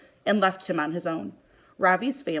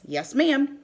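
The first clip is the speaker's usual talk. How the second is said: crisp higher reply, like a soldier; at attention; 7 semitones higher than usual. A narrator's reading. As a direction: snap to attention with Yes, ma'am. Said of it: "I say, standing at attention".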